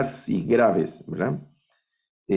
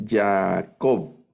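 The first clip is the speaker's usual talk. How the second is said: Jacob said Ya-a-KOV, end stressed; stress on the last syllable. Shown as a demonstration. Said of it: "Al final el acento". (Jacob acentuado al final)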